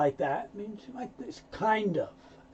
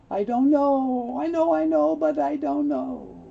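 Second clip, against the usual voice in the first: unsure, reluctant; 8 semitones higher, 6 dB louder than usual. Acted. Teacher acting the man who can't decide, reluctant. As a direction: stretch it out in a high wavering-over-it tone. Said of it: "I don't know if I want to do it".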